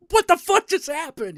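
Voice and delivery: high shocked outburst. Retold or imitated. Hero shocked by rapture scene.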